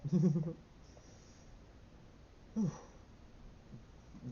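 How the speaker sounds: long, breathy, voiceless laugh; trailing airy chuckle. Real, not acted. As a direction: laugh mostly on air. Laughs after drug remark.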